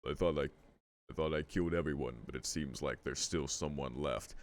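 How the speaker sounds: low brooding villain read; deep villain voice. Shown as a demonstration. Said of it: "it has to be a deep voice".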